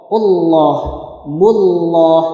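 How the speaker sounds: ALLAH and BULLAH with a thick, dark L, ending "lloh"; heavy lam. Shown as a demonstration. Teacher drilling the heavy reading, heavy lam in ALLAH and BULLAH. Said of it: "Contoh yang dibaca berat".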